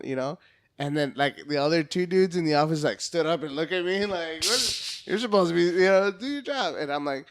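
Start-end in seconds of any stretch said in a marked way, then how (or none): 4.4-6.8 higher, disbelieving; incredulous. Retold or imitated. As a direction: question it in shock. Coworkers' incredulous reaction quoted.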